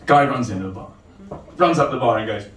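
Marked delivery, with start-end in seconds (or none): none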